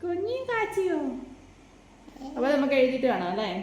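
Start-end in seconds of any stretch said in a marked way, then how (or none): 0.0-1.0 high, cooing question; high-pitched mother's coo. Real, not acted. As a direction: soothe a little one in a high voice. Mother cooing to crying child.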